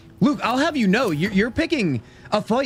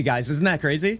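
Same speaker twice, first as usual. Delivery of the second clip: ordinary talk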